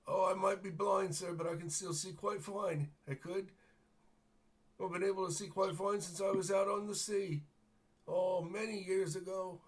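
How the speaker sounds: put-on high-pitched, wistful old seafarer voice; old blind sailor. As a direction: play a half-blind old man reminiscing. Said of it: "and he says".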